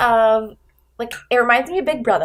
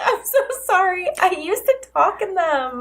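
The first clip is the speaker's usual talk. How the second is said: higher, lightly pleading retold apology; mock apology; 7 semitones higher than usual. Host replays her apology to a listener, mildly pleading.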